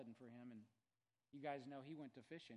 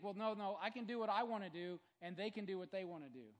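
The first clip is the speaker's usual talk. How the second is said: higher, louder mock protest; mock defiant listener; 6 semitones higher, 10 dB louder than usual. Preacher mocks a defiant churchgoer, raised voice. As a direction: play the stubborn believer. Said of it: "You think".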